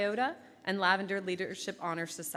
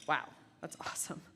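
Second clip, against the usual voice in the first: soft whispered wow; whispered aside; 3 semitones lower, 4 dB quieter than usual. Real, not acted. Aside whisper after quote.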